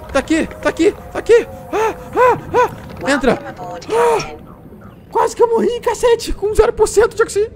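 high panicked cries, racing for air; frantic, voice up high, then breathless relief; real panic. Real, not acted. Gamer lost in a cave, running out of oxygen, panicked high cries.

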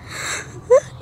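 sharp voiceless mock gasp; imitated gasp. Stung friend who can't speak, imitated gasp. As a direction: suck in air as if unable to speak.

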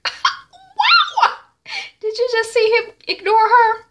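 very high, squealing amazement through laughter; laughing aside. Retold or imitated. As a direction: crack up gleefully at someone's snub. T Y mocks the snub, aside.

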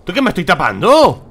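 loud exasperated yell; raging gamer. Real, not acted. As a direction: yell in frustration at the game. Frustrated at game.

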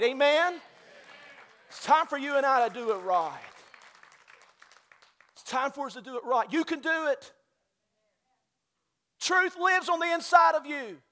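loud, high, climbing preacher's call; preaching at full force. Acted. Pastor rousing the church in full preaching cry.

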